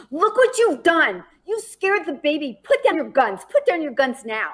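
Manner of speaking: a retold mother ordering gunmen to put down their guns, high and commanding. Mother confronts armed men at car.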